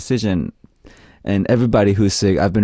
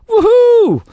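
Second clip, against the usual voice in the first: very high, loud whoop; mock-Aussie cheer; 7 dB louder than usual. Radio host acts out a listener's joy at passing, high cheer. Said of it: "I'm gonna read this in Aussie accent".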